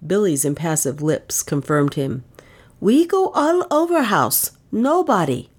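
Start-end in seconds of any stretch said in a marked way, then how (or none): 2.8-4.5 servant voice, much higher, odd timbre; reporting the empty house to his mistress in a high, odd voice; Billy's report. Acted. Servant Billy telling his mistress nothing was found, pitched high. 4.7-5.5 high, falling servant voice; a short flat "Nobody." in Billy's high voice; flat report. Acted. Billy closing his report to his mistress, high and flat.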